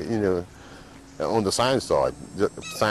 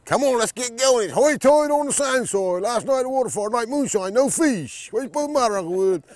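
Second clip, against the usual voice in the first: mimicking the uncle high and a bit louder, in thick brogue; teasing uncle; 12 semitones higher, 5 dB louder than usual. Islander recalls his uncle teasing him while fishing, voicing him high. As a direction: pitch up to play the teasing uncle. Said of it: "my uncle used to always say it".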